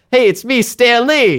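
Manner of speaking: put-on high old man Stan Lee; chirpy high cameo old man. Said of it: "he's like".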